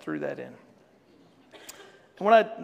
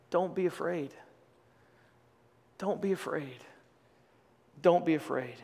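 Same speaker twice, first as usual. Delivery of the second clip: soft, gentle, repeated reassurance; reassuring; 4 dB quieter than usual. Real, not acted. Pastor reassuring the anxious in his congregation, soft and gentle. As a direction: lay it on them gently, like comfort.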